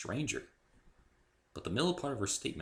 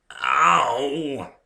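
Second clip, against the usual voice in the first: louder pained yelp; 7 semitones higher, 11 dB louder than usual. A narrator's reading. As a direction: cry out from the hit. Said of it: "I moaned".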